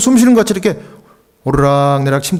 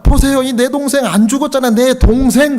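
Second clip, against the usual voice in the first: a twin's raised denial; insistent protest; 9 semitones higher than usual. Retold or imitated. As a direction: demand that they look. Twin insists brother not dead.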